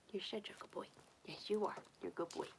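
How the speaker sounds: hushed, doting praise for a pet; soft cooing. Real, not acted. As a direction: drop to a near-whisper for the bunny. Cooing softly to pet bunny.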